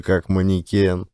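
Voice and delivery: plain reading voice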